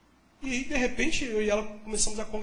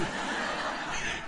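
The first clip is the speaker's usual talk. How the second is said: breathy laugh; 6 dB quieter than usual. Real, not acted. Laughs at his own joke.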